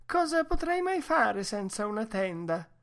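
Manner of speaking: high-voiced woman, daintily appalled; high plaintive question in delicate consternation; dismayed Mercedes. A narrator's reading. Woman who cannot do without her tent, high dismayed protest. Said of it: "con delicata costernazione".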